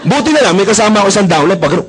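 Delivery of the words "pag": normal talking voice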